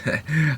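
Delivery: a quick high nervous laugh; short awkward laugh. Real, not acted. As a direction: laugh it off uneasily. Awkward laugh after disgust.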